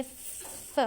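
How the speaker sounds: voiceless फ as pure air; just blow फ out; whispered f. Shown as a demonstration. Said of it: "ये फ की आवाज़ आ रही है". (फुसफुसाती फ ध्वनि)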